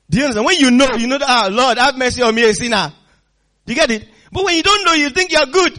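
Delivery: loud, high-pitched preaching; impassioned preaching. Acted. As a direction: preach it out forcefully to the congregation. Preaches at full force.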